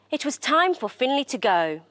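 ordinary delivery, nothing marked

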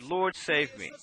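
soft, low retold cry for help; a hushed plea from a sinking man; quiet plea. Preacher voicing sinking Peter, soft low plea. And he cried out and said.

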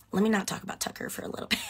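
quick hushed whisper with a laugh. Real, not acted. Dodging fans' questions about Tucker, whispered. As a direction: drop to a secret whisper and rush it. Hushing a touchy topic on stream.

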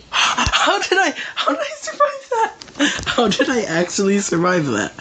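very high, laughing in disbelief; relieved laughter. Real, not acted. Laughing relief after escape.

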